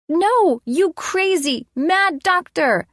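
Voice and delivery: put-on high, slow child's voice; defiant little boy. A narrator's reading. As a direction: stretch it out like a boy facing a play villain. Small boy defies play villain.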